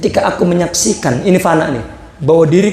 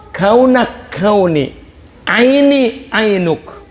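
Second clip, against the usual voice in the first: slow recitation; 3 semitones higher, much slower than usual. Shown as a demonstration. Reciting Sufi verse aloud.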